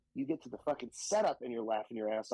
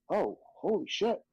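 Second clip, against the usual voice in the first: stunned reaction; 7 semitones higher, 4 dB louder than usual. Retold or imitated. Retelling stunned reaction to celebrities arriving.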